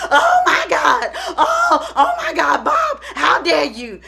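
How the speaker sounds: hammed-up, high wailing shock; slow, theatrical gasp of horror; badly faked motherly dismay; mock outrage. Mother's reaction acted out mockingly.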